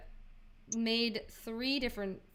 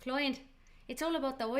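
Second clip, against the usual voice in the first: put-on Irish accent; 4 dB quieter than usual. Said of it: "I did Irish yesterday".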